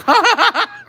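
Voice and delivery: sharp loud laugh bursting out; sudden high burst of laughter. Real, not acted. Laughing at the weed brag, sudden loud laugh.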